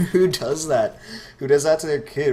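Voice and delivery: who would do that, exclaim it; incredulous. Real, not acted. Incredulous at a classmate's name.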